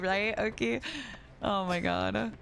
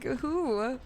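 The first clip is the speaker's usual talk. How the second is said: higher-pitched, stammering disbelief; mock bewilderment; 7 semitones higher, slower than usual. Asking who's calling me this, mock bewildered. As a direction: act baffled by who is calling. Acts out confusion at her full name.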